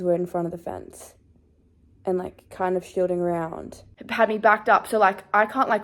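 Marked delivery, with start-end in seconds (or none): none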